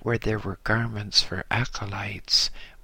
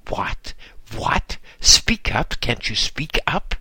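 bellowed; 5 dB louder than usual. A narrator's reading. Master bellowing at the whispering serving monk, loud. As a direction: roar it out, impatient and scowling. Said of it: "scowled and bellowed".

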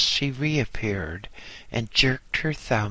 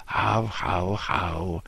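roaring laugh; 4 semitones lower, slower than usual. A narrator's reading. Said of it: "he roared".

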